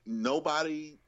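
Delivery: ordinary talk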